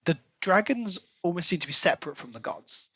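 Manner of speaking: ordinary talk, nothing marked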